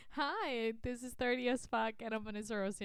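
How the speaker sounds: normal talking voice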